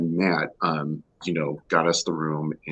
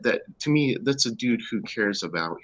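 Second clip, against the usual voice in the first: ordinary talk, nothing marked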